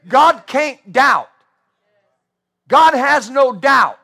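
slow, loud declaration; slow, loud preacher's insistence; emphatic preaching. Acted. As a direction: lean on every word. Repeating the point.

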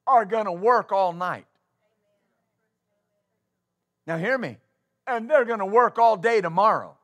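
loud, rising preacher's cadence, driving each point home; preaching at full force. Acted. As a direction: preach it hard to the congregation.

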